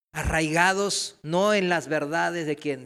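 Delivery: usual speaking voice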